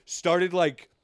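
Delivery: normal talking voice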